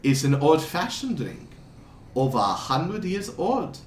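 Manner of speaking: put-on lilting, vaguely European accent, pitch swooping up; wandering foreign accent. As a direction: play a courtly stranger with a muddled European accent. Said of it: "his accent keeps jumping around a lot".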